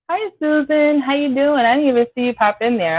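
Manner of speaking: light up as if spotting a friend; cheery greeting. Real, not acted. Greeting a viewer joining the live.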